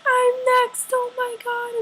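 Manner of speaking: very high excited squeal; high-pitched fangirl freak-out. Retold or imitated. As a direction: squeal it like a fan losing control. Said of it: "I started freaking out".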